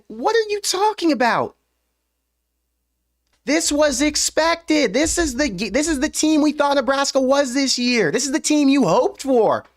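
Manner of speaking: high-pitched, incredulous outburst; triggered rant. Real, not acted. Host triggered by negative fans' comments, high exasperated rant. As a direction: protest in disbelief, drawing the words out high. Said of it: "I get triggered by it".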